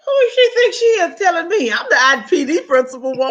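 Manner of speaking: indignant outrage. Retold or imitated. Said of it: "I was like".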